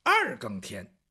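usual speaking voice

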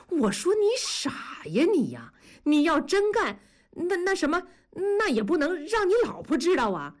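half-teasing, half-exasperated friend; a hint of a know-it-all pal ribbing a friend; Dazhuang scolding his friend. Dazhuang voiced in story.

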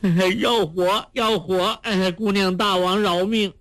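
high, frightened begging; acted high-pitched plea for mercy; pleading for life. A narrator's reading. Fat couple begs their captor to spare them, high pleading. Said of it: "与那胖子齐声说".

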